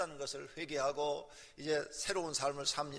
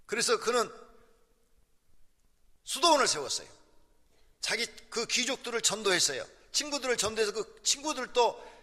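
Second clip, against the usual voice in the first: higher, louder sermon voice; fiery preaching; 4 semitones higher, 7 dB louder than usual. Acted. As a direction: preach it out to the congregation. Preaching at full force.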